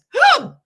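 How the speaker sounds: high, loud whooping hiccup; sudden explosive yelp of a hiccup. Shown as a demonstration. Comedian showing viewers her loudest hiccup, loud whoop. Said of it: "it sounds like this".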